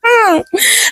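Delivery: short high-pitched acted laugh; mocking laugh. A narrator's reading. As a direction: let out a brief, high laugh. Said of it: "started laughing".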